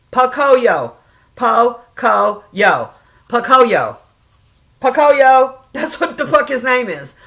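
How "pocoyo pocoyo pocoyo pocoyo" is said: "Pocoyo" loud and emphatic, syllable by syllable; slow, deliberate pronunciation. Shown as a demonstration. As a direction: sound out "Po-co-yo" firmly. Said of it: "I have to say it slow".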